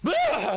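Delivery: loud, high yelp of fright; startled yell. Acted. Boss tapped on the shoulder from behind, loud startled yelp. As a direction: jump out of your skin with a yell.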